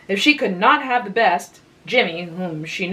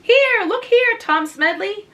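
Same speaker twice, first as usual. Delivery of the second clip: much higher, louder, eager interruption; breaking in; 14 semitones higher, 4 dB louder, slower than usual. A narrator's reading. Anne interrupting Tom to show him the mole, excited. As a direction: cut in excitedly, pitched high. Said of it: "broke in Anne".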